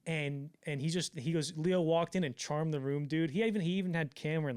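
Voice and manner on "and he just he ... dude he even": ordinary talk, nothing marked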